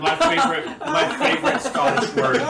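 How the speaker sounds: high, loud through laughter; laughing. Real, not acted. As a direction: giggle the words out. Laughing while starting.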